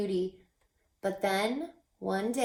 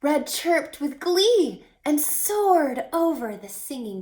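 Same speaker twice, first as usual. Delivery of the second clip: higher and louder, racing to the climax; thrilled storyteller voice, higher and louder; building excitement; 9 semitones higher, 7 dB louder than usual. A narrator's reading. Climax of the bird's search.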